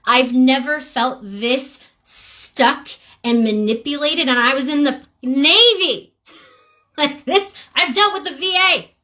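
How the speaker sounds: fed up and worked up, pitch rising sharply; mad, exasperated. Real, not acted. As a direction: let the anger climb into a high, loud outburst. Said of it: "'cause I'm just mad".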